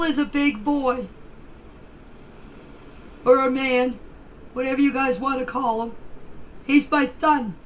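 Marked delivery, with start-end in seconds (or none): none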